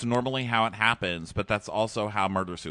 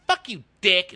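high, loud imitation of a girlfriend; mock angry insult; 10 semitones higher, 7 dB louder than usual. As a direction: hurl it as a parody of a lovers' spat. Imitating her usual quarrel with boyfriend.

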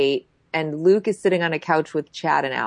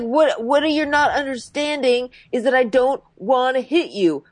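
raised, pleading explanation; imitating a man pushed too far; mock exasperation; 7 semitones higher than usual. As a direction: plead your case as if cornered. Acting exasperated Chad.

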